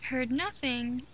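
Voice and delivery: ordinary delivery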